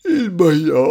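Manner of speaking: loud yawn through the words. A narrator's reading. Narrator yawns through the word. (dit en bâillant bruyamment)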